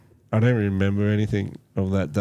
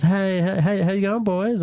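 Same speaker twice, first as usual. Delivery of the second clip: higher, sing-song friendly greeting; imitating old farm lady; 12 semitones higher than usual. Old farm lady greets the mushroom-picking boys, high and sing-song. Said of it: "and she goes".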